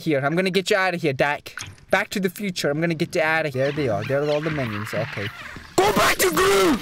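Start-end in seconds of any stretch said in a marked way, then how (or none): 5.8-6.8 high, loud urgent yell; shout. Real, not acted. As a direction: yell the command out. Shouting order in game.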